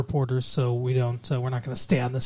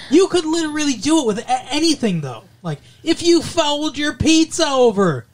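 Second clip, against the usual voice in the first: mock joke setup; 11 semitones higher, 5 dB louder than usual.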